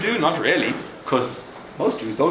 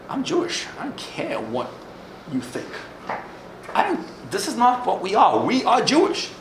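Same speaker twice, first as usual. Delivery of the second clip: retold grandfather, pitch jumping much higher; defiant outburst; 8 semitones higher than usual. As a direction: fling it back at her, proud and stubborn. Grandfather defies his daughter.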